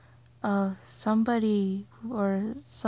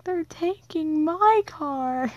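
higher, louder, slow wail of alarm; retold panicked cry; 8 semitones higher, 4 dB louder, slower than usual. Her mom's car about to be stolen, a high slow panicked cry. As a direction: cry it out as if the car is already gone. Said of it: "and I was like".

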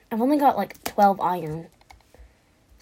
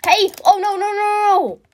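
high, loud wail of protest; alarmed cry; 12 semitones higher, 6 dB louder than usual. Real, not acted. Gamer caught out mid-fight, alarmed high wailing. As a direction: cry out and stretch the last no.

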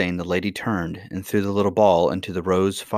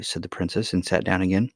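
plain reading voice

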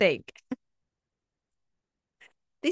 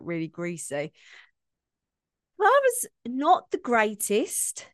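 usual speaking voice

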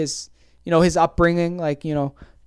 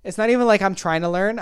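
ordinary talk, nothing marked

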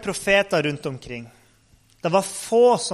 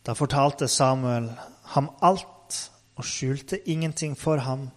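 usual speaking voice

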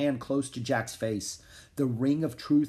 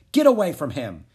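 loud, high, sharp order; commanding; 4 semitones higher, 9 dB louder than usual. A narrator's reading. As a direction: order him off forcefully. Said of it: "he ordered the man".

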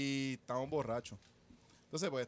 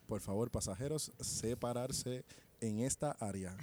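softer, slow announcement voice; lightly voiced cabin announcement; flight attendant voice; 5 semitones lower, 4 dB quieter than usual. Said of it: "me tiro la voz de azafata".

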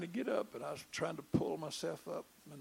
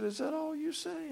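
surprised; 12 semitones higher, 5 dB louder than usual. Retold or imitated. Pastor puzzled by repeated yes.

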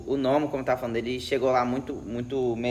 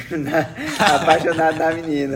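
laughing; 5 dB louder, slower than usual. Real, not acted. Laughing while mocking the character.

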